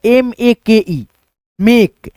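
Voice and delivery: एम ए के ई, each letter slow and separate; slow dictation of letters; spelling out slowly. Shown as a demonstration. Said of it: "पढ़ेंगे दोस्तों हम लोग".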